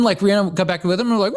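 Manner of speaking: ordinary talk, nothing marked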